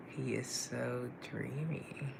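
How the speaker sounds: slow, swooning whisper; sighing whisper over a crush; dreamy whisper. Real, not acted. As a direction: breathe it out like a crush. Swooning over a handsome man.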